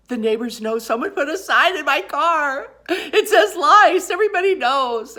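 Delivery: very loud, high wail; hammed-up meltdown, wailing to husband that the secret is out; full-volume retold hysteria, pitch way up; acted hysterical sobbing. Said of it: "I was just sobbing".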